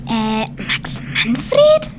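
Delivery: incredulous whisper. Acted. Incredulous at silly name.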